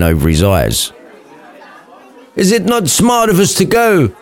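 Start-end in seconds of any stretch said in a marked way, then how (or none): 2.4-4.1 mild mock shout. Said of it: "shouted Romeo".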